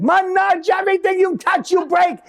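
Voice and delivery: high shouting Italian dad, furious; father blowing up at his son, shouting in a high Italian voice; ballistic father. Retold or imitated. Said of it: "my father goes ballistic".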